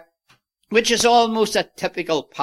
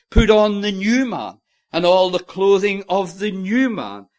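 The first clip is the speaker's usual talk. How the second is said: ordinary talk, nothing marked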